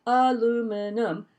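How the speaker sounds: "aluminum" slow, four syllables a-LU-mi-num. Shown as a demonstration. Insisting to Australians on the four-syllable "aluminum", slowly. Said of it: "This is a four-syllable word".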